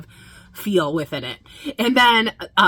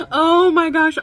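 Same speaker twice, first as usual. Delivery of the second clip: excited gush; 9 semitones higher, 5 dB louder, slower than usual. Real, not acted. Gushing over the song.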